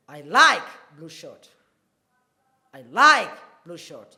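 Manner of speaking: like punched loud, the rest even; stress on like. Shown as a demonstration. Teacher showing the class, stress on like. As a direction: hit the word like hard. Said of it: "like there is the loudest of all the words".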